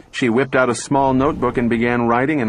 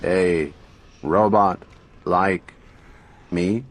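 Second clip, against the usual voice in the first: slow, toneless robot voice with no intonation; flat mechanical voice; 4 semitones lower, slower than usual. A narrator's reading. Talking robot booming its answer to Gloria, mechanical and flat. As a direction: speak like a machine, every word level. Robot stutters over the concept.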